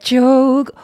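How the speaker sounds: jog squeezed loud in a tight throat; constricted. Shown as a demonstration. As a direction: choke the sound in the throat. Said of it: "constriction on the word hold".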